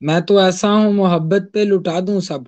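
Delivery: measured ghazal recital, each line lingering; poetry recitation. Acted. (غزل کا ترنم سے پڑھنا)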